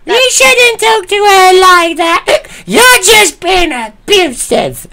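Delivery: high, slow put-on woman's voice, scolding; mock drunk friend. Mimicking the drunk friend telling him off, slow and high. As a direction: drag it out high and preachy.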